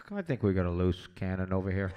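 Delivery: low, loud report into a microphone; imitated production assistant. Production assistant warns crew over microphone, low imitated voice.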